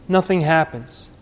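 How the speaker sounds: ordinary talk, nothing marked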